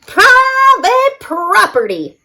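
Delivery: high, slow cactus yell. A narrator's reading. Hank yelling at the tortoise to get off his land. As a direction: bellow it at the trespasser. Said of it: "yelled Hank".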